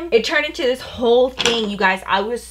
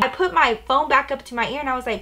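plain reading voice

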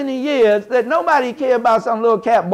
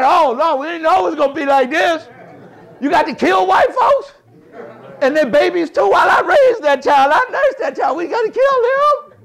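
horrified ancestors; 8 semitones higher than usual. Retold or imitated. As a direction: act out the shocked old folks. Ancestors aghast at Nat Turner.

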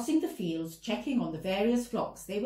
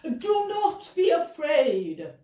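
slow, louder and higher angel's proclamation; booming angel voice; 7 semitones higher, 8 dB louder, slower than usual. Acted. Angel booming to the terrified shepherds. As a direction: boom it like thunder from the sky. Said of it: "in a great booming voice".

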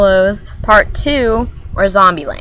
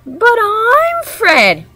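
much higher, slow, squeaky twin voice; Weasley twin; 4 dB louder, much slower than usual. Retold or imitated. Vlogger acting a Weasley twin, higher and slow. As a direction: squeak it out, protesting slowly. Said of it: "the, the Weasleys".

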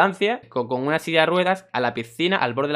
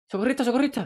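put-on worried kid calling; 9 semitones higher, faster than usual. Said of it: "viene un chaval todo preocupado".

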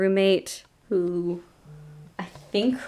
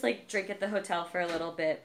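ordinary talk, nothing marked

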